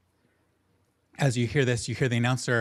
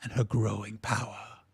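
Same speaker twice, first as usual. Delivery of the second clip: hushed drops on the dramatic words; announcer's changed tone. Acted. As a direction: draw out the ominous introduction. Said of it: "his tone change. He goes".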